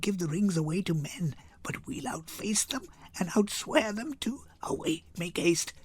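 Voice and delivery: hushed whisper to a friend; whispered aside. Acted. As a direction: whisper it so the men can't hear. Portia's secret aside to Nerissa.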